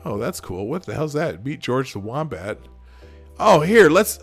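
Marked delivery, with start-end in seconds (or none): none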